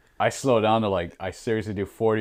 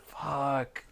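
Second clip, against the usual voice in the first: muttered curse; 4 semitones higher, 9 dB quieter than usual. Retold or imitated. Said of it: "and I was like".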